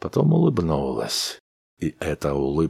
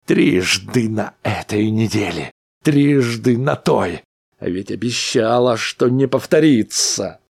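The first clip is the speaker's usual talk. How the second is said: angry reproach; 6 semitones higher, 5 dB louder than usual. A narrator's reading. Husband accuses wife.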